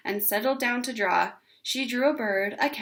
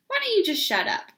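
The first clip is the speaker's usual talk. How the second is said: sharp retort; 11 semitones higher, 3 dB louder than usual. A narrator's reading. Ramona retorts to teasing.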